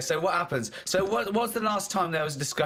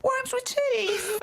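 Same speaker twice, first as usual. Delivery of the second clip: very high, very slow dramatic announcement; mock grand reveal; much slower than usual. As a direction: drag it out like a big reveal. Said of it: "Okay, wait for this".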